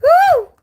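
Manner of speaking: high loud joyful shriek; joyful whoop. Real, not acted. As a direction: let out an ecstatic whoop. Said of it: "I'm getting emotional now".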